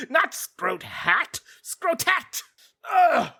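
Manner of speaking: slow then high whiny villain correction; fussy villain correcting his name. Pretend.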